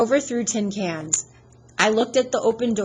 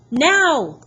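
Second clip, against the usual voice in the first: high, loud sharp command; yelling; 8 semitones higher, 9 dB louder than usual. Acted. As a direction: snap the final order. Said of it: "Annabeth yelled at me".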